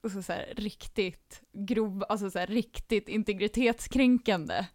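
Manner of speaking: ordinary delivery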